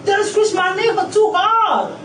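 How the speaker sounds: high, pained complaint; upset complaint. Real, not acted. Detainee complains to officer about her knee, high and pained. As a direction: complain of the pain pitched high.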